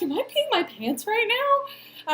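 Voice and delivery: shocked, high and slow; a panicked, high question to herself; alarmed high squeak. Retold or imitated. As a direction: squeal it in disbelief. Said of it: "I was like".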